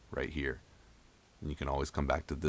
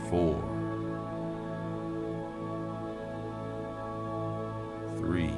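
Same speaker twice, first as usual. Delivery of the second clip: slow calm countdown; guided breathing; 6 dB quieter than usual. Acted. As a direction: count each breath gently down.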